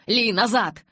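loud, high-pitched order; urgent command. A narrator's reading. Jacob orders the wolf back, urgent command. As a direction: call her off sharply.

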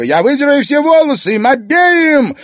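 very high, loud shriek. A narrator's reading. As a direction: shriek to be let go. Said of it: "визжала Зверева".

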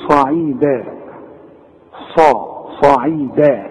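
صعيدا drawn out slowly with a full, heavy ص; heavy emphatic saad. Shown as a demonstration. Quran teacher drills emphatic ص for students. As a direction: stretch the ص in صعيدا, deep and emphatic. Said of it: "تقول لي".

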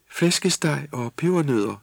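high, slow dreamy murmur; delirious elf girl. A narrator's reading. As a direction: ramble it as if half asleep. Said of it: "taler i vildelse". (fantaserende i vildelse)

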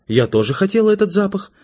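high-pitched, put-out wife; voiced wife, pitched well up; indignant. A narrator's reading. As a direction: protest that you deserve it too. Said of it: "возмутилась Светлана".

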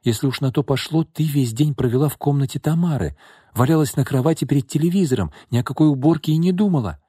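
high-pitched, quickening protest; indignant rebuttal, speeding up. A narrator's reading. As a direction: hit back with a rush of counter-accusation. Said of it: "возмутилась Наташа".

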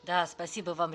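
normal talking voice

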